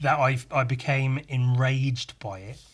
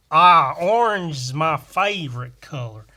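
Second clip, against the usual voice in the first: loud, hammed-up American; mock an American gushing about a colour; big exaggerated American voice; American accent; 7 dB louder than usual. Shown as a demonstration. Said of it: "They say orange".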